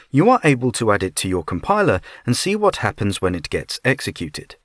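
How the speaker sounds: usual speaking voice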